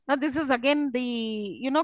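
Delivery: ordinary talk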